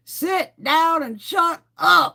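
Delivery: drawn-out, high, loud holler; Miss Crabtree. Acted. Streamer imagining herself as a cartoon bus driver, slow furious yell. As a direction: yell it slowly, hitting each word hard. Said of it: "I'd be Miss Crabtree from South Park".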